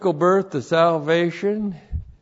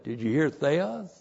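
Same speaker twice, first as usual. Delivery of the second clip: ordinary delivery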